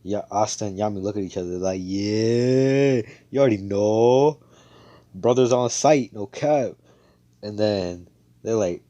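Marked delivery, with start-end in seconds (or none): 1.8-6.7 loud, higher, drawn-out brotherly cheer; mock bro-bonding holler; hyped-up agreement. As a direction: stretch the words and let them swell with hype. Two brothers' retold hyped agreement.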